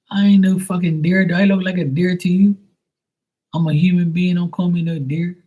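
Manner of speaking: loud, high-pitched protest; indignant retort. Acted. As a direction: object hotly, pitched well up. Indignant retort to being called dear.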